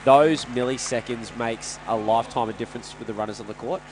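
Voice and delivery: usual speaking voice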